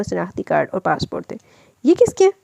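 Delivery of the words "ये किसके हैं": sharply higher, alarmed ask; wife finds hidden ID cards, startled; startled question. A narrator's reading. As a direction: catch your breath at the find.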